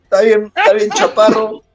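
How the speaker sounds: high, loud scornful exclamation; mocking disbelief. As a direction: point him out with loud incredulous scorn. Retold promoter mocking the young wrestlers.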